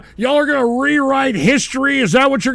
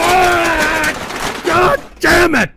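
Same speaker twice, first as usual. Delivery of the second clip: higher shouted no's and curse; frustrated angry shouting; exasperated yelling; 5 semitones higher, slower than usual. Real, not acted. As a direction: yell in exasperation to stop it. Host yelling at another clip.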